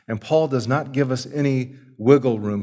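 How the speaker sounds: usual speaking voice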